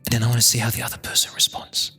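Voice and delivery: ordinary talk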